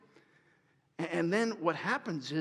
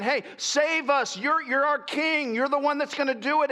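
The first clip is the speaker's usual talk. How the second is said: eager mock crowd plea; crowd hailing king; 7 semitones higher, 4 dB louder than usual. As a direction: call out to your new king. Crowd voiced pleading to king.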